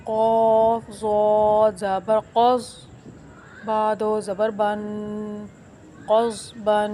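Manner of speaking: slow, emphatic letter naming; slow spelling drill read aloud; slow spelled-out recitation. Shown as a demonstration. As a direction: build क़ज़ बन slowly from its letters. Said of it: "सही तरीका पढ़ने का".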